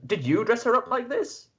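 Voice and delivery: high, sceptical knightly query; knight questioning. Acted. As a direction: ask it like a suspicious knight. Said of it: "and he goes".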